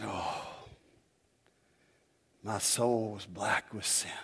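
slow, soft, drawn-out imitation of E V Hill; lightly voiced old preacher; E V Hill impression. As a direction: savour each word like the old preacher. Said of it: "the way he did it".

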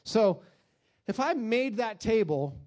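usual speaking voice

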